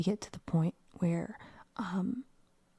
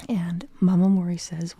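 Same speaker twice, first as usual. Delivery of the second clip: ordinary delivery, nothing marked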